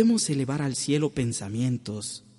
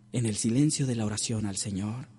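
normal talking voice

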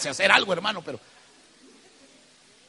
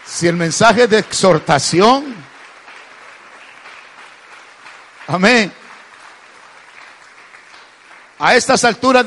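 louder, slow exhorting from the pulpit; preaching; 10 dB louder than usual. Acted. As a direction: call out to the back rows.

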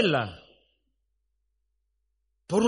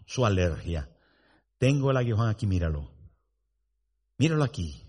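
calm father; 9 semitones lower than usual. Acted. Father calming son and wife.

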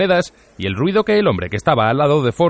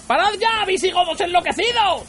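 very high-pitched, frenzied shout; furious yelling. A narrator's reading. Arthur raging at the demolition crew, frenzied yell. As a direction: hurl the insult in a high scream. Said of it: "gritó Arthur".

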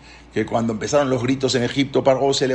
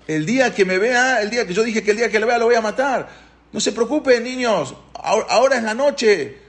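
acted high, insistent appeal; high-pitched pleading; 9 semitones higher than usual. Paró telling children not to worry since it is night, high acted pleading.

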